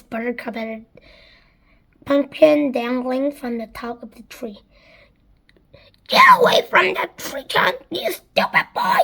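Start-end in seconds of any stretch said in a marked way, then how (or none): 6.1-9.0 high scolding yell; harsh, high-pitched telling-off. A narrator's reading. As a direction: yell at the boy to back off. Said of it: "as Spiker yelled".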